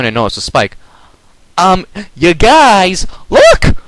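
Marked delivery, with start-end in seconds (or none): 1.6-3.8 slow then very high loud scream. A narrator's reading. Spike spotting the object, high screamed warning. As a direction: build into a shriek. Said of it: "Spike screamed".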